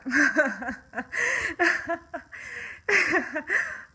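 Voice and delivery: loud, high unhinged laugh; psychopath laugh. Real, not acted. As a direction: laugh like things are too terrible to cry. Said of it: "that psychopath laugh".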